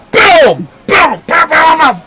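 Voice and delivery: high, punchy boom noises; imitated punch booms. Shown as a demonstration. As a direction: fire off high mock impact sounds. Imitates punches landing in fight.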